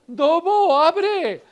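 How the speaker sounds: high, loud pleading call; little sheep shouting at the wolf's door, high and loud. Acted.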